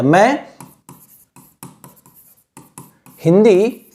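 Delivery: long pauses between words as if writing; dictating slowly. Shown as a demonstration. Teacher dictating the Hindi meaning while writing it out. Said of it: "मैं एक बार लिख देता हूं".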